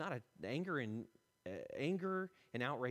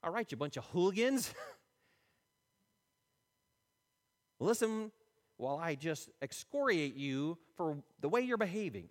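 louder, harsh mock rebuke of hooligans; mock scolding; 5 semitones higher, 6 dB louder than usual. Paul as he didn't speak to the mob, mock angry scolding. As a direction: bark at them like a fed-up preacher. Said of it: "He didn't say".

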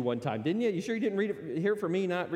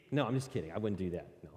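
usual speaking voice

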